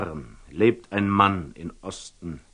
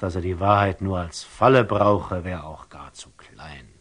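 ordinary delivery

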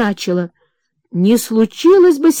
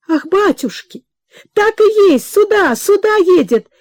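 excited cry; 10 semitones higher than usual. Acted. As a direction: burst out as the bell comes to the porch. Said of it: "кричала Анна Павловна".

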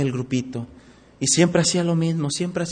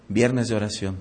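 normal talking voice

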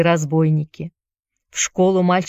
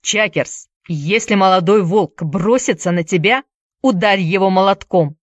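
ordinary delivery

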